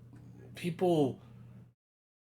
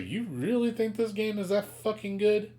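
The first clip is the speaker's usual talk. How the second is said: incredulous; 9 semitones higher than usual. Retold or imitated. Incredulous retort to friends.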